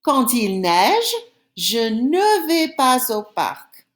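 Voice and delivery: slow, deliberate French; teacher reading a new example slowly; slow model reading. Shown as a demonstration. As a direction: say it slowly for the class. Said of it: "Here's another one".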